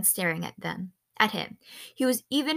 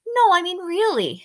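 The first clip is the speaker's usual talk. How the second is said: put-on high, loud, insistent girl; persist, pushing for a real answer; pitched up and emphatic; 11 semitones higher, 9 dB louder than usual. A narrator's reading. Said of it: "she persisted".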